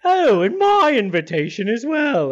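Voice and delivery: lightly voiced gentleman. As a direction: toss it off like a dandy. Character speaks to guard.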